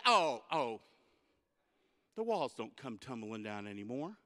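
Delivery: put-on old man correcting. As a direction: gently set the kid straight. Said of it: "And he goes".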